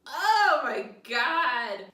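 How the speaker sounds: loud, slow, drawn-out gasp of horror; stretched-out loud shock; horrified outcry. Acted. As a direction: bellow it out slowly in disbelief. Needy sees spikes in vomit.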